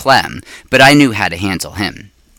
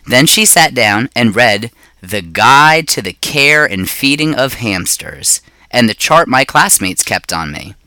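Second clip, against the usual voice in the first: usual speaking voice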